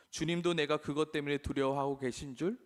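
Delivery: ordinary delivery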